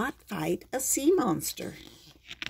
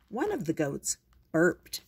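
usual speaking voice

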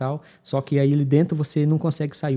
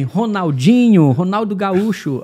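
triumphant name drop; 6 semitones higher, 7 dB louder, slower than usual. Retold or imitated. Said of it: "Aí eu falei".

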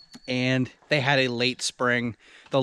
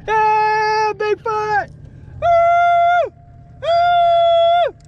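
excited cheering; 7 dB louder, much slower than usual. Real, not acted. Celebrating the Bigfoot answer.

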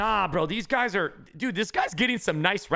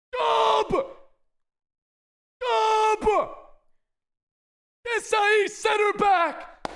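slowed, soaring victory yells; hype shouting; 12 semitones higher, much slower than usual. Real, not acted. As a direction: scream each win at chat. Celebrating pack pulls.

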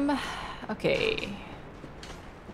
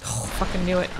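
normal talking voice

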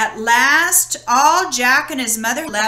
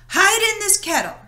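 much higher urgent hiss of alarm; alarmed urgency; 8 semitones higher, faster than usual. Acted. Giant's wife rushing Jack to hide, alarmed. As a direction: hurry him out of sight.

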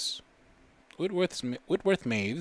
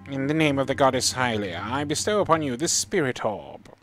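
a hint of a solemn old monk, louder and higher, reading his blessing; 4 semitones higher, 6 dB louder than usual. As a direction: lightly voice the grand old monk bestowing the orb. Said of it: "what does this old dude talk sound like? Let's choose the voice".

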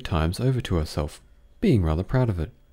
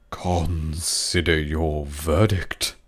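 low, very slow royal voice; a king solemnly charging the jury; 4 semitones lower, much slower than usual. A narrator's reading.

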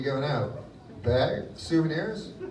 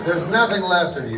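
normal talking voice

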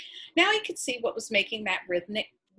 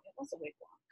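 near-silent whispered self-correction; whispered aside; 4 semitones higher, 17 dB quieter, faster than usual. Real, not acted. Reader whispering to herself while correcting the word. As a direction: drop to a whisper to fix the word.

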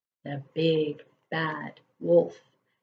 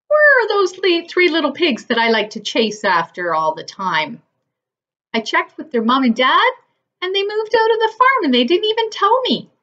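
higher, louder wolf voice; a hammed-up wolf complaining; wolf grumbling about the pigs, raised pitch; lightly voiced wolf; 9 semitones higher, 6 dB louder than usual. A narrator's reading.

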